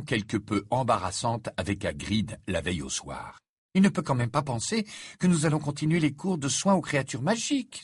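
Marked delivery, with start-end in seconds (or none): 3.7-7.8 mildly panicked, higher-pitched protest; alarmed exclamation. A narrator's reading. Hermione hearing about Hagrid, alarmed. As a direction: exclaim in dismay, pitch lifted. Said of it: "s'exclama-t-elle, l'air affolé".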